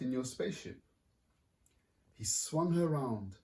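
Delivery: lively picture-book reading; story narration. A narrator's reading. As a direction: read it like to a room of kids. Author reads picture book aloud.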